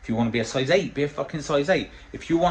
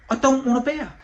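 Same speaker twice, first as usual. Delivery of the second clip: high, loud refusal of a beer; mock refusal; 12 semitones higher, 5 dB louder than usual. Imitating himself turning down a beer at the pub. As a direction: pitch it up and push back. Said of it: "Well, I went".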